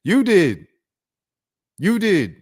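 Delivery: high, firm, stressed YOU; emphatic retort. Real, not acted. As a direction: insist on it.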